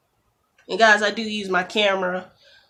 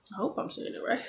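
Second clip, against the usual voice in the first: soft, unvoiced doubtful aside; whispered worry; 4 semitones higher, 8 dB quieter, faster than usual. Real, not acted. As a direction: mutter it to yourself. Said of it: "repeats doubt in a whisper".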